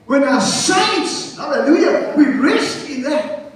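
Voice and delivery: high, urgent preacher voice; full-force preaching. Real, not acted. Preacher telling congregation they rest in salvation, full-force preaching. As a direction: drive each phrase out to the congregation.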